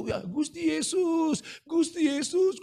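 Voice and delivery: seruan tinggi dan lambat, menirukan orang menangis; meniru tangisan. Retold or imitated. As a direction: tiru ratapan orang yang baru bertobat. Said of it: "dia nangis". (ratapan tiruan yang tinggi)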